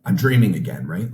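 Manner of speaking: loud, high, as if waking from a dream; a burst of loud disbelief; stunned disbelief. Retold or imitated. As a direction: blurt it out in shock. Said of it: "in disbelief".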